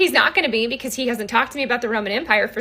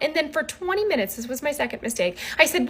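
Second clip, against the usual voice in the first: ordinary delivery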